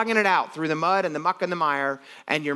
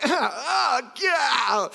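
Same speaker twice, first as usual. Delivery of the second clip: high, shouted mock exclamation; mock yelp; 9 semitones higher than usual. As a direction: play up the gross-out shock with a yell. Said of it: "And you're like".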